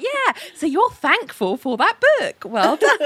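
put-on high, sing-song praise to a child; praising a toddler. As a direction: talk to a toddler, high and bright. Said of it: "I'm like".

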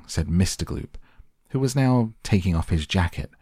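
ordinary delivery

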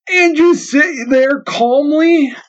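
high, slow, stretched-out shout of disbelief; the captain shouting at Grandma in disbelief. Acted. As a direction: bellow it in slow amazement. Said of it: "shouted the captain".

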